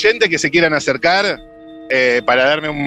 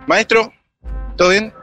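higher-pitched street hail; hopeful hail, pitched up; calling to a passer-by; 7 semitones higher than usual. Real, not acted. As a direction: stop a stranger with a high greeting.

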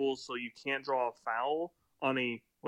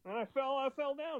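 whiny flopper; 10 semitones higher, 3 dB quieter than usual. Retold or imitated.

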